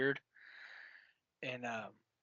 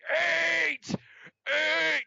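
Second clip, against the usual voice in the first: much higher, loud scream of "Eight"; 12 semitones higher, 6 dB louder than usual. Retold or imitated. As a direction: scream it at the phone. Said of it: "basically screaming on my phone".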